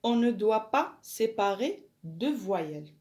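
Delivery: slow, deliberate French read-out; slow dictation. Shown as a demonstration. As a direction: spell it out slowly for pupils to copy. Teacher dictates French rule.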